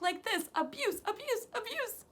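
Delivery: playful mock attack. Star pretending to beat fans, playful mock attack. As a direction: squeal it in time with pretend blows.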